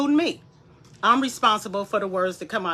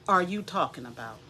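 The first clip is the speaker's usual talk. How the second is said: ordinary delivery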